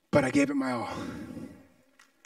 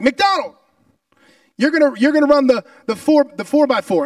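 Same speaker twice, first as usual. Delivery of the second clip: louder and higher, fast orders from the coach; coach barking orders; 5 semitones higher, 7 dB louder than usual. Retold or imitated. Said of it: "he's like".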